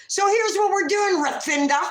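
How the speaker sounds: high, loud and emphatic; retold bossy announcement. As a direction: take charge of the clerk, pitched right up. Replays laying down terms to clerk.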